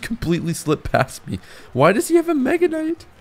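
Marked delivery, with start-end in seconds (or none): 1.7-3.1 very high-pitched bewildered question; high, baffled outcry; incredulous. Real, not acted. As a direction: squeal it in disbelief at the surprise card. Baffled by opponent's surprise card.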